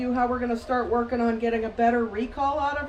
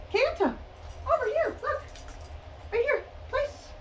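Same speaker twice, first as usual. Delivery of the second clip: calling from far away; 11 semitones higher, 4 dB quieter than usual. Real, not acted. Calling dog across the room.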